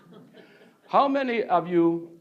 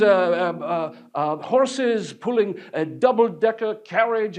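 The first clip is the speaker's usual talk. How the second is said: ordinary talk, nothing marked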